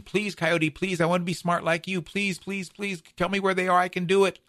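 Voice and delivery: high, insistent pleading; begging Badger. Badger keeps pleading with Coyote for berries, high and begging. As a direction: keep begging, high-pitched and urgent. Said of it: "Badger keeps begging and pleading".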